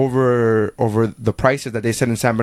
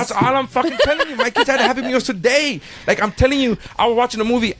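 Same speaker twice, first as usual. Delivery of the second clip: excited insistence; 11 semitones higher than usual. Real, not acted. Excited insistence about hero.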